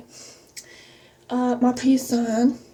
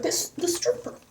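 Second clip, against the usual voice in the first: breathy whisper, a bit higher; whispered aside; 4 semitones higher, 8 dB quieter than usual. Real, not acted. To viewers about the stripper, a hushed whisper. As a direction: lean in and whisper the name.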